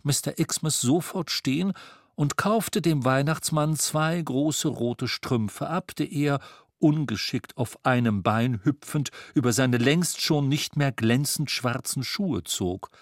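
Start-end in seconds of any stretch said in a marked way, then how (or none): none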